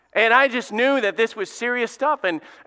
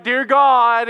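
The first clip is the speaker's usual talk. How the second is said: mock-emotional public prayer, raised and loud; showy prayer; 4 semitones higher, 6 dB louder than usual. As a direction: play a lightly hammed-up pious prayer out loud. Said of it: "they would be emotional".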